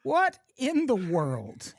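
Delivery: milk the show's name like a trailer voice; show title announcer. Acted. Host echoes the podcast's name, slow and hammed-up.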